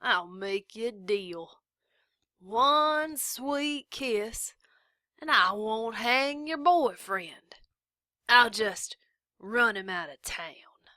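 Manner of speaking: slow, hushed leering offer; sly drawl. A narrator's reading. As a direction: whisper a dirty bargain. Sheriff's sly deal.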